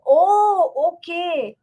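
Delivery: sudden high surprised "oh". Real, not acted. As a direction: caught off guard, then understanding. Reading surprising viewer comments.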